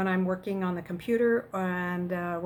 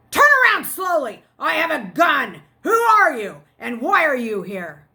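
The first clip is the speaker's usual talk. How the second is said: loud, commanding shout; booming voice; 6 semitones higher, 11 dB louder than usual. Acted. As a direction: bark orders at an intruder. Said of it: "a voice boomed".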